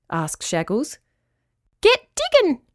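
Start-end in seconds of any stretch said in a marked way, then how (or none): 1.8-2.6 very high, squeaky order; tiny bossy crab voice; squeaky little creature. A narrator's reading. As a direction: squeak an urgent command. Squeaky crab urging dog.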